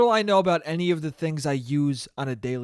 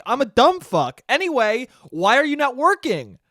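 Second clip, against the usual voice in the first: high, loud mock indignation; Karen-y complaining; 6 semitones higher, 4 dB louder than usual. Karen confronting idle repair crews, Karen-y complaining. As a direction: push it out as an entitled demand. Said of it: "I was getting real fucking Karen-y".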